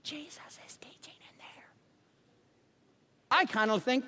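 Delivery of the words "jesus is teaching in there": hushed whisper of an usher; stage whisper at the door. Retold or imitated. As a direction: shush them in a whisper. Ushers hushing people at door.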